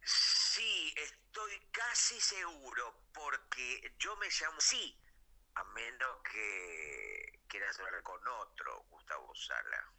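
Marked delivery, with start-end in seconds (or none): 5.5-9.9 conspiratorial whispered aside; whispering. Acted. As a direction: breathe it like a secret. Joking aside about other Gustavo.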